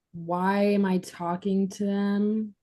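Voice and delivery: ordinary delivery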